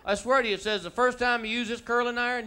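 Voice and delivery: ordinary talk, nothing marked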